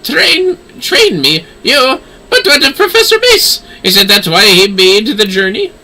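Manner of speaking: flustered professor. Acted. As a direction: caught off guard and offended. Flustered professor voice, pitch jumps.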